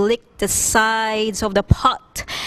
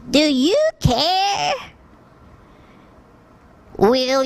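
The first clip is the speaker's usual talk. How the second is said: put-on high, slow, pleading old woman's voice; pleading old lady; 5 semitones higher, slower than usual. A narrator's reading. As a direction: beg for food like a tired old woman. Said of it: "the old lady said".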